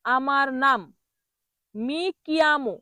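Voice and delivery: ordinary talk, nothing marked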